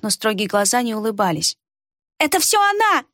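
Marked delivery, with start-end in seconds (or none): 2.2-3.0 high accusing exclamation; exclaim. A narrator's reading. The pockmarked girl accuses Хейта, high shrill exclamation. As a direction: cry it out, pointing the blame. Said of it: "воскликнула Рябая".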